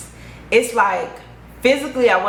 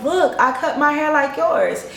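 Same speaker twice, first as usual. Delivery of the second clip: higher, eager show-and-tell; dazed delight; 7 semitones higher than usual. Retold or imitated. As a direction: proudly point at your own hair. Her dazed happy self showing off.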